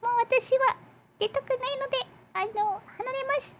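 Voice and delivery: tiny, very high, soft character voice; Manju. Pretend. Manju made to greet the viewers, tiny high voice. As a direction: squeak it softly, a shy little creature. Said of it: "You have to say hi".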